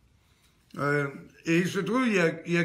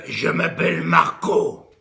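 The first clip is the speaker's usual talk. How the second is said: put-on lower, loud gruff man's voice from a child; booming deep voice; 4 semitones lower, 6 dB louder than usual. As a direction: rumble it so the windows shake. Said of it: "t'as fait trembler les vitres".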